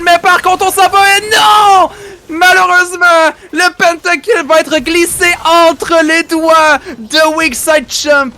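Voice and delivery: high, loud disappointed shout. Real, not acted. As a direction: scream it as the pentakill slips away. Pentakill narrowly missed, caster shouts.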